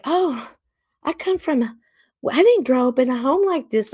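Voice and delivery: high mocking imitation of her prissy claim. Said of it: "She likes to say".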